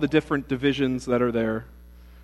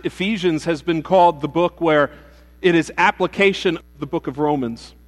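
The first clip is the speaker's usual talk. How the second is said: usual speaking voice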